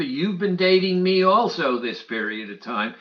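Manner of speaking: ordinary talk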